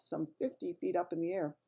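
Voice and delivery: ordinary delivery, nothing marked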